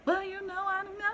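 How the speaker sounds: high, soft, unsure spoof voice; lightly voiced dim guest. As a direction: mumble it high like a talk show spoof. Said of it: "they'd be like".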